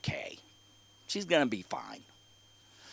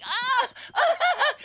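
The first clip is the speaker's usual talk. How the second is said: put-on high shouted rhythmic wails of a toddler; child crying. Imitating daughter wailing while carried home, high shouted wails. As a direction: wail high in bursts like a hurt kid. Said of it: "she is literally going".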